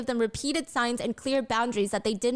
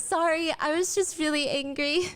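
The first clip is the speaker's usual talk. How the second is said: smiling through tears; 5 semitones higher than usual. Retold or imitated. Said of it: "turns around smiling and wipes a tear".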